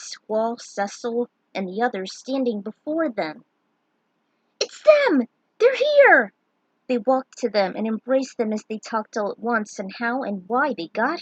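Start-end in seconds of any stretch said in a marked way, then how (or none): 4.6-6.3 much higher and louder, thrilled shout; excited cry. A narrator's reading. Friends spotting the heroes arrive, thrilled shout. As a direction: squeal with joy at seeing friends.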